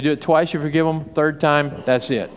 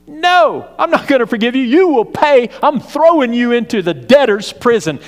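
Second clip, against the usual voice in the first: loud, high, furious creditor; a servant turns on the man who owes him; angry refusal; 7 semitones higher, 5 dB louder than usual. A narrator's reading. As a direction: bark it at the debtor. Servant refuses debtor, shouting.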